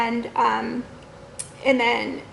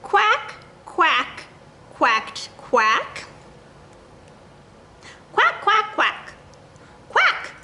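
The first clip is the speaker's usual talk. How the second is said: duck quacks; 6 semitones higher, much slower than usual. Acted. Duck quacking in picture book.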